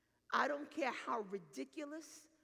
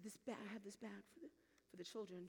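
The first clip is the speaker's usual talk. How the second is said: very soft, halting repeat; sheepish murmur; 3 semitones lower, 11 dB quieter than usual. Retold or imitated. Pastor repeats confession to woman in charge, sheepish murmur. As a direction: stumble through it under your breath.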